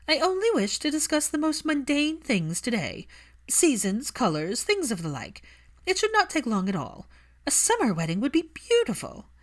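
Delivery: a lightly voiced older witch cornering Hermione, falsely sweet; sweetly pushy Narcissa. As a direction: coax her into wedding plans with mock innocence.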